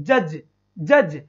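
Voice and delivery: "जज" slow, with a slight vowel between the two ज; careful isolated repeats of "जज"; light vowel demo. Shown as a demonstration. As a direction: say "जज" slowly, letting the short middle vowel show. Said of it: "एक हल्की सी एक की sound आ रही है".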